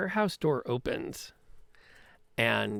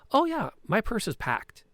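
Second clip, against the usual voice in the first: high, chipper password answer; coded reply; 9 semitones higher, 4 dB louder than usual. Acted. Petra gives the thug the password, high and chipper. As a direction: give the countersign brightly.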